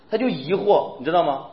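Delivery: ordinary delivery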